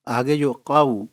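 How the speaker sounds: normal talking voice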